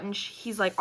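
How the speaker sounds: normal talking voice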